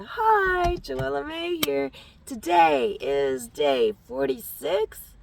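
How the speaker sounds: loud, bright-pitched show opening; formal introduction. Pretend. Vlogger formally introducing herself to viewers, loud announcement. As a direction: announce yourself at full volume. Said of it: "formal introduction".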